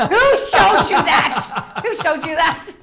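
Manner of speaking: shocked laughing question. Real, not acted. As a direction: laugh through it in disbelief.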